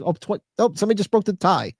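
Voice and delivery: normal talking voice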